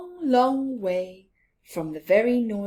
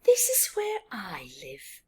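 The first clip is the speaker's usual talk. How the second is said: whisper; 2 semitones lower than usual. Acted. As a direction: breathe it like a secret. Badger whispers in story.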